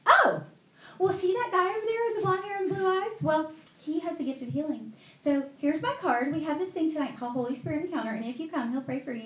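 high mimicked sales pitch; eager evangelist friend. Friend invites cashier to healing meeting, high and eager.

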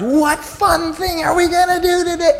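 put-on high squealing little-girl shout; excited little girl. Daughter waking dad early, imitated as a high excited shout. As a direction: shout it like an eager kid bouncing on the bed.

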